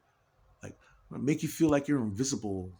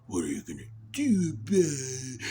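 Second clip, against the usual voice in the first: praying mantis taunt. Pretend. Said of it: "another praying mantis noise".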